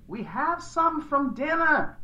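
high, slow, soft far-off reply; wife calling back. A narrator's reading. Wife objecting to making coffee, calling back. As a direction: draw it out high, as from another room.

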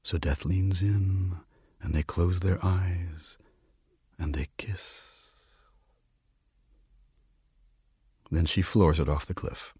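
slow, softening storyteller's reading; slow right down, leave long pauses; tender, quiet close to a tale; hushed story narration. A narrator's reading. Reads a story aloud.

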